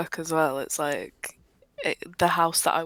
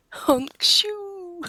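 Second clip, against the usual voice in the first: snoring; 13 semitones higher, 4 dB louder than usual. Pretend. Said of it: "Is that a thing?".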